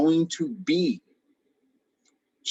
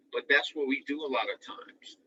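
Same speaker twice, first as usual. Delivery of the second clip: ordinary talk, nothing marked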